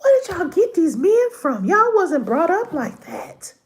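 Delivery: high, exasperated scolding of daughters; "where did y'all get these men from", asked high and fed up; mock scolding mother. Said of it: "I'm like".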